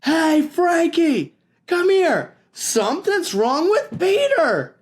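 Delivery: high, loud call across the playground; yell. Acted. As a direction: shout to a friend far away. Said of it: "Really, it was a yell".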